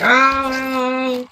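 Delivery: high drawn-out mock yell of pain; mock pained scream. Officer imitates inmate held in a wrist lock, mock screaming. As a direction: imitate someone screaming as if badly hurt. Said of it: "just yelling and screaming like it's always in severe pain".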